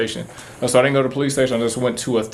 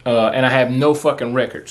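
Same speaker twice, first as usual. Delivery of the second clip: ordinary delivery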